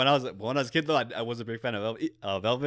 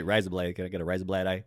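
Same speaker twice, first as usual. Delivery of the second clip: lower-pitched mock Australian accent; 5 semitones lower than usual. Friend playfully asking for a razor blade, Australian accent. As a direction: put on an Aussie accent, "razor" as "rise-a". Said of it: "Say razor blade in an Australian accent".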